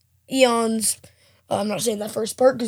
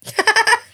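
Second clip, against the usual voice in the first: laugh; 9 semitones higher than usual. Real, not acted. Laughing at long review.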